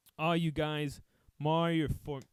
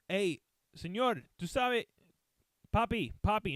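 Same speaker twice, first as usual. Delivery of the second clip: imitated street talk; 5 semitones higher than usual. Firemen coaxing the lost old man, Spanish accent. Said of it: "that's how they talk".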